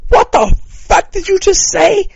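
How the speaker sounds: very high, loud, drawn-out challenge; slow, shrieked mock confrontation; imagined outraged woman. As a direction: imitate an offended woman yelling in outrage.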